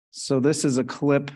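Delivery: ordinary delivery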